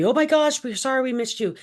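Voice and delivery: high, loud "oh my gosh" easing off; exclaimed high-pitched apology, acted out; gushing mock apology. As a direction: imitate a flustered, over-apologetic receptionist. Acts out apologetic office staff.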